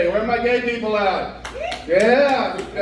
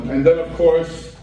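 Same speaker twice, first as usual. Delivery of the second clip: ordinary talk, nothing marked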